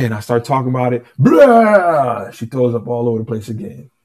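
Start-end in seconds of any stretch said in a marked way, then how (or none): 1.2-2.3 loud imitated retch; loud mock retch as she vomits; mock vomiting noise. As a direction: act out throwing up, high and loud. Wife throwing up again.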